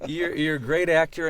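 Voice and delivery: ordinary talk, nothing marked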